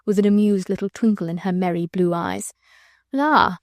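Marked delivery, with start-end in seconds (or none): 3.1-3.6 high, lazy La; foppish exclamation. A narrator's reading. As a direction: drawl it like a fop. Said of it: "said Sir Percy".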